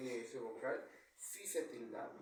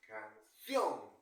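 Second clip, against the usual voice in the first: "Canción" con la última sílaba mucho más fuerte; acento en última sílaba; 3 semitones lower, 8 dB louder than usual. Shown as a demonstration. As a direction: golpea fuerte el final de "Canción": can-CIÓN. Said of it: "¿Dónde tiene su mayor fuerza de voz?". (sílaba tónica final marcada en "Canción")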